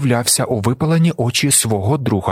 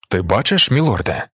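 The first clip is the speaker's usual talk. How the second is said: understated amazed question; astonished; 3 semitones lower than usual. A narrator's reading. Stilgar questions his blinded lord, mildly astonished. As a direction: ask with a touch of wonder. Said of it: "з подивом спитав Стілгар".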